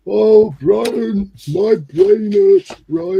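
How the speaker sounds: silly whiny voice. Pretend. Mock Python whine.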